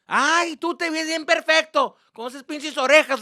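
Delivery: high, loud scolding mom voice; mock mother's sarcasm. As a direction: throw it back sarcastically as mom. Acted mother's sarcastic comeback.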